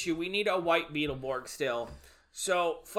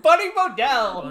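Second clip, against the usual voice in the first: mock-grand proclamation; 8 semitones higher, 10 dB louder than usual. Hosts mock-proclaim reporter's name.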